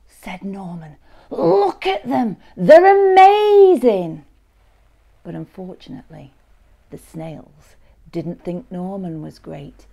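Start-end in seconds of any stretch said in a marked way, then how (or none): 1.3-4.3 high, loud and drawn-out wonder; awestruck admiration. Acted. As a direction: gush slowly as an adoring little slug. Norman admires the snails.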